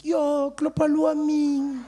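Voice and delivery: lightly voiced teasing friend; Snowflake. Snowflake guessing the bad news, high teasing voice. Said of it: "Snowflake was like".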